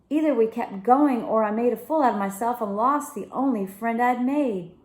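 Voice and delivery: usual speaking voice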